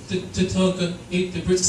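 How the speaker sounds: lightly voiced little girl, hesitant; a hint of a child confessing, stammering; daughter's voice. Daughter telling dad about the dog, lightly voiced. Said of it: "My daughter goes".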